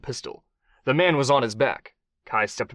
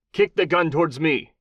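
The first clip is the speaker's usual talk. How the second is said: higher, louder fake Mexican accent; thick Mexican accent; 6 semitones higher, 4 dB louder than usual. A narrator's reading. Kai disguising himself with a fake Mexican accent, commanding. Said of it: "thick, false Mexican accent".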